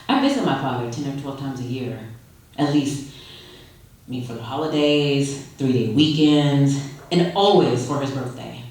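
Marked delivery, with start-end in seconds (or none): none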